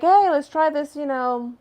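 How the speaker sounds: loud, high, easygoing offer; hammed-up host pushing a joint; imitating Joe Rogan. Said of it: "Joe's like".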